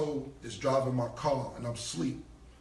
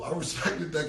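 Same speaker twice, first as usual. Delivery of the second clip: ordinary talk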